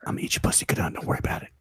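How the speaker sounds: mock ASMR sports whisper. Host doing a fake ASMR sports segment, whispered. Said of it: "A S M R Sports".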